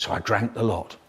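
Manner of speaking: ordinary delivery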